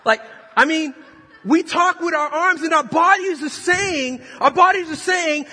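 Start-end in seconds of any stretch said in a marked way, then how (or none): 1.5-5.5 loud, high preaching build; rising shout. Acted. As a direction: climb into a full shout.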